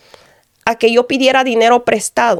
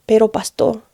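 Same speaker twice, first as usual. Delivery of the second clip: ordinary talk, nothing marked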